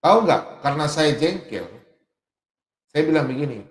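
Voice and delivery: ordinary talk, nothing marked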